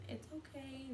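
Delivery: soft, hushed reassurance; soothing. Retold or imitated. Devin's mom holding her hand, softly reassuring. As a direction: murmur it to calm her down.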